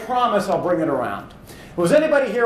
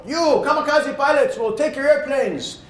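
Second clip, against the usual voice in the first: higher put-on commander voice, shouting the orders; Japanese commander; 9 semitones higher than usual. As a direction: play the Japanese commander from the old war movie, voice pitched up. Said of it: "Japanese commander go".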